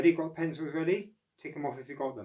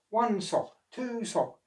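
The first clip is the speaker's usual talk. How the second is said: higher, careful counting voice; counting aloud; 9 semitones higher, slower than usual. Acted. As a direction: count the socks one by one. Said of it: "counted Oscar".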